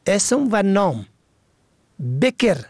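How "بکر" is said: بکر said "beker"; کسره on first letter. Shown as a demonstration. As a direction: say بکر with a short e after b. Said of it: "به کسر اول". (بکر با کسرهٔ ب: بِکر)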